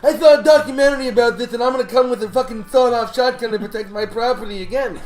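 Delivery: high, loud raging rant, fast; enraged owner throwing a customer out; furious deli owner. Acted. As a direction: scream him out of the shop.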